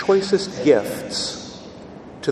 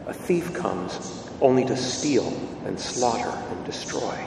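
Gospel reading; 4 semitones lower, 6 dB quieter than usual. Acted. Priest proclaiming the Gospel at Mass, solemn reading.

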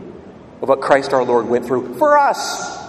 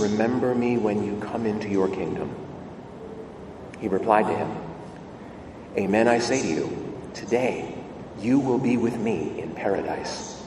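measured, solemn reading of scripture, a little lower and softer; Gospel proclamation; 6 semitones lower, 6 dB quieter than usual. Acted. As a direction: priest reading the Gospel aloud at Mass.

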